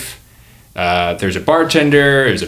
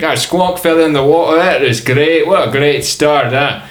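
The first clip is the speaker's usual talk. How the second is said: put-on higher, louder muddled Australian-Scottish accent; comic host in a jumbled Australian-Scottish accent; mixed-up comic accent; 6 semitones higher, 5 dB louder than usual. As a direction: exclaim in a wandering Australian-ish brogue. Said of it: "He's Australian and Scottish".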